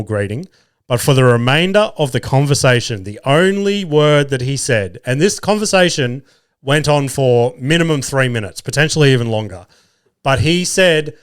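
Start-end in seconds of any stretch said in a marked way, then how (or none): none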